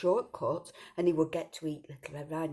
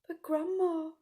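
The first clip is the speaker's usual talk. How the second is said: put-on much higher slow fearful girl; scared child; slower than usual. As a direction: quaver it, frightened.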